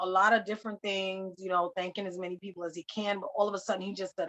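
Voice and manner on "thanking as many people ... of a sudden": normal talking voice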